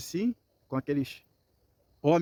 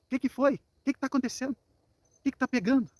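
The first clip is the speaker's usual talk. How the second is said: high-pitched mock confrontation; put-on indignant questioning; acted challenge; 8 semitones higher than usual. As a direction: play men turning round to challenge a pursuer. Danites challenge their pursuer.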